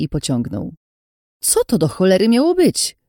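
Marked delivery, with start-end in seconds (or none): 1.4-3.0 high, raised-voice scolding; exasperated, indignant outburst; angry shout. A narrator's reading. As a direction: yell at someone who ran off. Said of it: "krzyknęła na mnie Ines".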